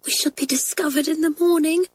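put-on a scared girl breathing her worry; frightened whisper. A narrator's reading. Kathleen fretting to friends outside at night, timid and high. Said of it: "she whispered".

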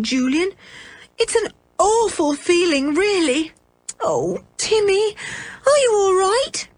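put-on high, plaintive child's lament; groaning misery.

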